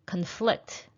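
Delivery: Conflict with a weak first syllable, stressed FLICT; stress on second syllable. Shown as a demonstration. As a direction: lean on the end of Conflict, as a verb. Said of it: "conflicted between these two options".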